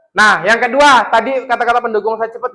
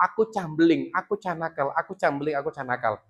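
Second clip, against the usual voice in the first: soft repeated self-talk; quiet murmured mantra; child's inner voice; 3 semitones lower, 8 dB quieter than usual. Shown as a demonstration. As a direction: echo it like a child's inner thought. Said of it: "yang termindset dalam kehidupannya".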